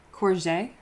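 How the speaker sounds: "Courgette" tried with a soft J, kor-ZHAY; "Courgette" as kor-ZHAY, soft J and an ay ending; French courgette. Shown as a demonstration. Trying the French way chat taught her, kor-ZHAY. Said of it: "You pronounce the G like a soft J".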